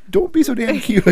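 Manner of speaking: dad melting at his daughter's offer, squealing; overwhelmed. Real, not acted. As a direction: gush as if about to cry.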